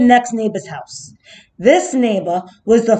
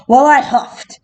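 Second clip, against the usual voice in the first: plain reading voice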